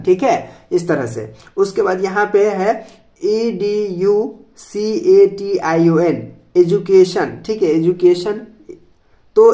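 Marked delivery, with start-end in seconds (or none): 3.2-6.2 slow, letter by letter, each letter apart; spelling out. Shown as a demonstration. Teacher spells education for students, slow letter by letter. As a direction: spell it out slowly for the class. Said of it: "spelling the word out".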